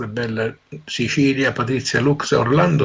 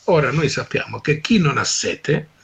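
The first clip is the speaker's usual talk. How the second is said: normal talking voice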